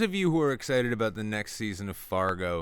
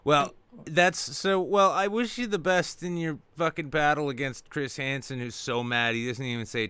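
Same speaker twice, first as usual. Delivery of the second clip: ordinary talk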